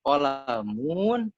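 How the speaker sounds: kolamun slow, with -muun stretched as if it had a waw; wrongly long mu. Shown as a demonstration. Showing students the mistake, kolamun with overlong -mun. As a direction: drag out the last vowel of kolamun. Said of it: "di mimnya ada waw".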